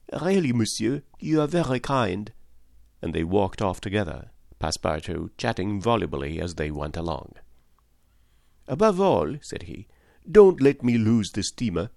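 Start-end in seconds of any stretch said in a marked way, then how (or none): 0.1-2.3 higher, eager chattering servant voice; excitable French valet. A narrator's reading. Valet telling Fix of Paris, eager higher voice. 8.7-11.9 higher, eager chattering servant voice; excitable French valet. A narrator's reading. Valet telling Fix of Paris, eager higher voice.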